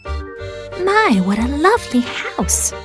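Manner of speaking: high, swooping delighted exclamation; Miss Susie, admiring. A narrator's reading. Miss Susie peeping inside the dollhouse, admiring. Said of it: "thought Miss Susie".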